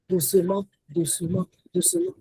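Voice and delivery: soft, low repetition; gently, gradually. Shown as a demonstration. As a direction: let it creep in softly. Showing how gradually coldness comes.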